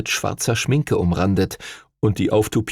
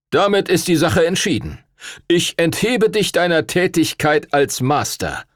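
lecturing official; 8 semitones higher than usual. Acted. Stuart Todd cites the statutes to Duncan, stern official tone. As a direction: lay down the law with cool authority.

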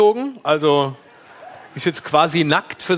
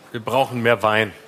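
Steinbrück impression; 10 semitones lower than usual. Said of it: "Den Satz habe ich von Steinbrück".

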